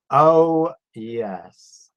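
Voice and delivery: normal talking voice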